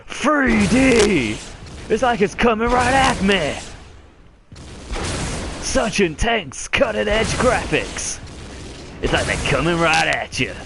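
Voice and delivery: high, hammed-up excited yelling; mock amazement. Player mocking the game's cutting-edge 3D graphics, exaggerated awe.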